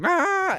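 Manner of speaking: high shrill comic shriek; mock scream. Homeowner reacting to the metal: high mock scream. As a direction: let out a cartoon yelp of horror. Horrified at the music.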